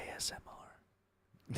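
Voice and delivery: very soft close-mic whisper; ASMR whisper. Shown as a demonstration. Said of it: "talked like this".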